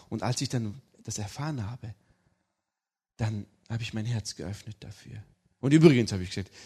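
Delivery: ordinary talk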